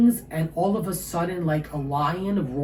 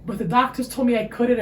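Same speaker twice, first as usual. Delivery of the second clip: ordinary talk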